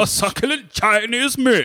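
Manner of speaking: high, loud theatrical British outrage; imitated well-spoken arrestee. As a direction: declaim it like a ham actor. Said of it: "he's so well spoken".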